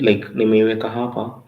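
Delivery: ordinary talk, nothing marked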